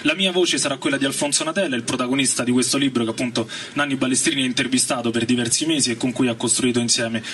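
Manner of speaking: ordinary talk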